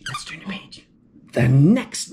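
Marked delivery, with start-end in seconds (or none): 0.1-0.9 whisper. Acted. Hushing so he stays asleep.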